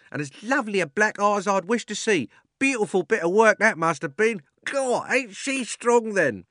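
high, loud cockney onlooker; cheeky bystander. A narrator's reading. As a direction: chime in from the crowd.

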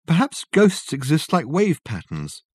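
high, slow, tentative suggestion; speculating. A narrator's reading. Richard suggesting a theory of ghosts, speculative. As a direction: float the idea thoughtfully.

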